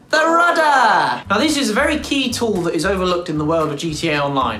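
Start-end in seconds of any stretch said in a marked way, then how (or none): 0.1-1.2 drawn out, high and loud; dramatic reveal. Acted. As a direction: announce it like a big reveal. Dramatic reveal of final topic.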